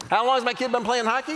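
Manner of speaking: usual speaking voice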